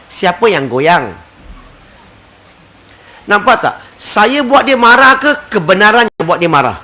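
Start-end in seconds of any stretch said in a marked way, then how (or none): none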